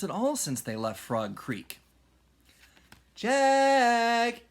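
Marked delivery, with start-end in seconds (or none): 3.1-4.4 high, loud, long drawn call; calling from afar. A narrator's reading. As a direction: call them home from far away. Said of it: "a voice called from the distance".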